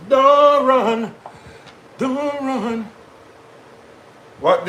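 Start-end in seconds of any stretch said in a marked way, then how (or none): 0.1-2.9 high-pitched sing-song call after a pet; high, teasing don't run. Real, not acted. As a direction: coax it back in a high voice. Calling to a running animal.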